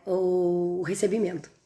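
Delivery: ordinary talk, nothing marked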